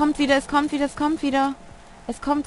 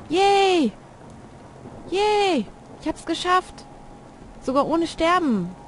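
cheering; 7 semitones higher, much slower than usual. Real, not acted. Escaped the monster alive.

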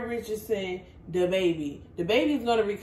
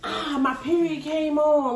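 higher, slow mock groan of pain; faked pain excuse; 6 semitones higher, slower than usual. Friend suggests an excuse for losing the race, mock agonized.

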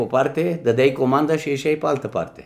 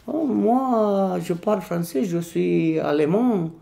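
lady voiced higher, with a foreign lilt; German lady; 6 semitones higher, slower than usual. Embassy secretary answers the waiter in French, pitched up. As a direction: play the embassy secretary, a touch lofty.

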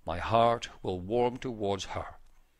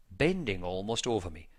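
plain reading voice

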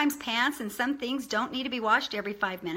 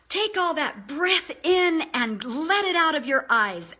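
slow, emphatic, higher-pitched enthusiasm; enthusiastic; 5 semitones higher, 4 dB louder than usual. Shown as a demonstration. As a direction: pump it up with big stretched-out stresses. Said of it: "just really be enthusiastic".

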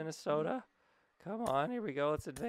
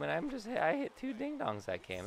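usual speaking voice

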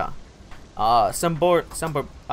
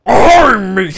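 mock pirate "Arr", high and loud; 5 semitones higher, 8 dB louder than usual. Gamer still doing the pirate bit. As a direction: bark a playful pirate arr. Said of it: "I'm sounding like a fucking pirate".

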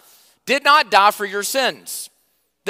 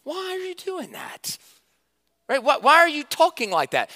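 God as a high, hushed, baffled voice; 10 semitones higher than usual. Retold or imitated. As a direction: ask it in a light, high, bewildered tone. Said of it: "God's going".

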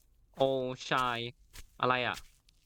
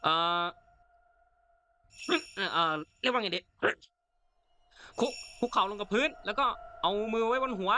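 raised, higher voice barking at a suspect; shouting an order; 5 semitones higher than usual. Pretend. Player shouting at the woman in the forest, commanding shout. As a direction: yell it like a cop making an arrest. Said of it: "อันนี้เราก็ตะโกนนะครับ".

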